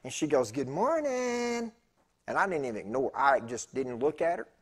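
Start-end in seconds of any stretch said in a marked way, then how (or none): 0.5-1.7 high, very slow singsong greeting; drawn-out good morning. Retold or imitated. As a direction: stretch it out sweetly.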